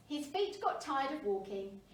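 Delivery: usual speaking voice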